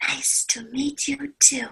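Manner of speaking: soft unvoiced cue line; whispered prompt. Shown as a demonstration. Teacher cueing the shy child, whispering the reply. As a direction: whisper the answer for her to repeat.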